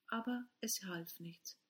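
ordinary delivery

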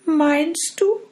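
put-on high, wondering young girl; doubtful girl.

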